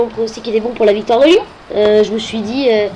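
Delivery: ordinary talk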